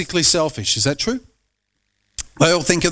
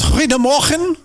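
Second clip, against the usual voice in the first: imitated Dutch greeting, pitched higher; Jim's Dutch accent; 6 semitones higher than usual. Preacher mimicking where someone is from, Dutch accent. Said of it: "not my, my Eng-, language or accent".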